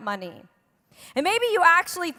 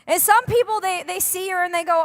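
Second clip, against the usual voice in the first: usual speaking voice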